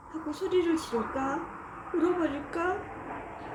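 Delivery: put-on slow, tearful child voice; lost child on the verge of crying. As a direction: hold back tears while wondering. Child near tears, lost.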